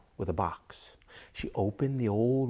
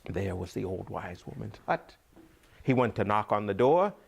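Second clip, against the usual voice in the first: ordinary delivery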